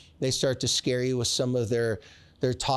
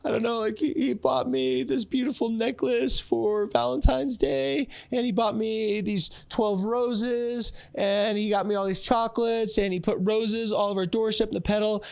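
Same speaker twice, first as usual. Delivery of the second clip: put-on squealing teenage girl voice, much higher; gushing teen daughter; 9 semitones higher than usual. A teenage daughter gushing to her dad, in a squealing high voice. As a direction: gush like a giddy fourteen-year-old. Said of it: "It's like".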